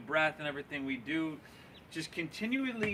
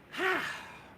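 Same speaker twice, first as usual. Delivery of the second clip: out-of-breath sigh; 14 semitones higher than usual. Real, not acted. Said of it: "I'm out of breath here".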